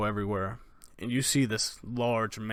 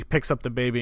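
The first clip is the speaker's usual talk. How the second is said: usual speaking voice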